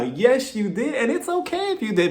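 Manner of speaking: usual speaking voice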